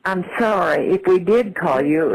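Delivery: usual speaking voice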